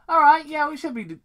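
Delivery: high, loud burst of hype; excited shout. Real, not acted. Reader hyping himself to keep reading. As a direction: blast it out, pumped.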